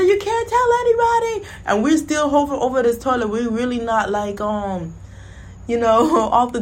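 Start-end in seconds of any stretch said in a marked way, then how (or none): 0.0-1.5 high pleading. Retold or imitated. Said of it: "I said".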